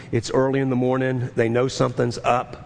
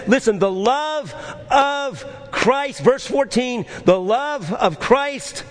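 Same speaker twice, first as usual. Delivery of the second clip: full-force preaching; 8 semitones higher than usual. Acted. Pastor urging his congregation to bring peace, preaching at full force. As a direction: preach it out with rising fire.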